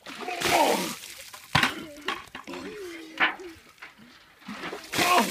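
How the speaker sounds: loud high gasps from freezing water; cold shock gasp. Real, not acted. Gasping under ice water.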